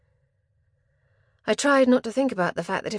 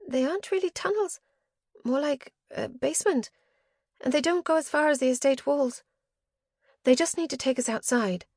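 high-pitched character voice, anxious; frightened companion; 7 semitones higher than usual. Acted. Companion explaining the tunnels to the narrator, anxious.

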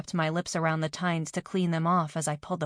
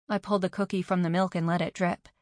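usual speaking voice

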